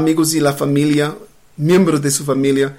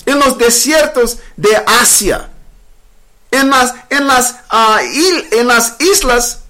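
raised pitch, urgent preacher's build; impassioned preaching; 7 semitones higher than usual. Real, not acted. Preacher to his livestream viewers about bomb tests, impassioned. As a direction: preach it with rising outrage.

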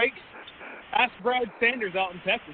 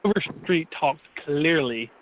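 slow and deliberate; 3 semitones lower, slower than usual. Acted. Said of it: "Still can't hear you".

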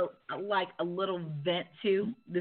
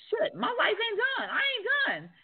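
much higher, anxious inner voice; panicked inner thought; 14 semitones higher than usual. Retold or imitated. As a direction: replay the fear of dying, pitch up high. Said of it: "And I feel like".

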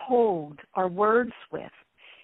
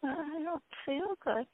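sick person whine; 7 semitones higher, 9 dB quieter, slower than usual. Shown as a demonstration. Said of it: "if someone's sick".

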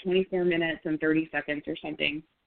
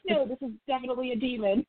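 mock spooked believer; 7 semitones higher, faster than usual. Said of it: "people who were like".

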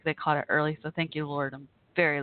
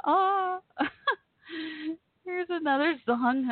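teary laugh; 10 semitones higher, slower than usual. Real, not acted. Still rattled after knee popped.

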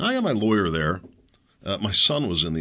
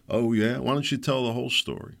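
usual speaking voice

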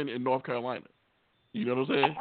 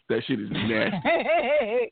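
slow, higher disgusted line; hammed-up disgust; 5 semitones higher, slower than usual. Host recoiling at okra fields, disgusted. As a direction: drag it out in disgust.

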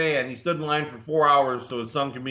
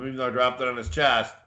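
ordinary talk, nothing marked

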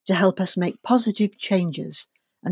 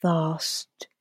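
guided meditation. Acted. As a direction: ease them gently into relaxation.

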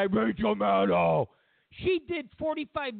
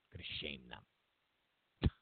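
much lower, softer, slow mock-ominous; mock deep shame threat; 11 dB quieter, much slower than usual. Mocking the plan to shame Russia, slow low fake menace. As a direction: drag it out in a fake ominous rumble.

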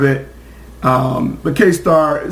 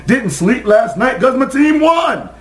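excited diehard fan; 10 semitones higher than usual. Retold or imitated. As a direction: pitch jumps high on the win. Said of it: "those diehard, loyal".

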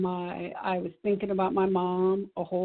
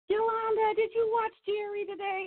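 mom on the phone. Retold or imitated. Mother voiced calling on phone.

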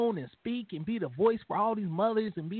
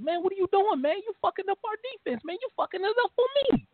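high, fast mock rant at a teammate; teammate going off; 12 semitones higher, faster than usual. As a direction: fire it off like a furious teammate. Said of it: "Landon Collins was going off on his ass".

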